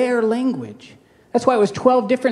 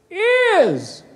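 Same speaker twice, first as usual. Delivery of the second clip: sudden much higher drawn-out "Is"; Kenneth Copeland impression; 11 semitones higher than usual. Pastor parodies a televangelist's dramatic pause, high drawn-out single word. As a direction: land one word with showman suspense. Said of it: "And he's like".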